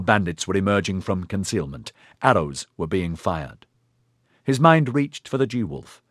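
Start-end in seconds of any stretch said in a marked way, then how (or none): none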